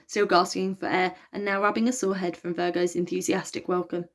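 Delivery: plain reading voice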